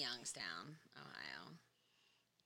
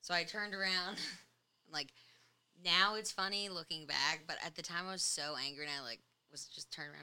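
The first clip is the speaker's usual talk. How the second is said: normal talking voice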